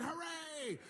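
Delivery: high hammed-up cheer; crowd cheer. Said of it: "the whole crowd said".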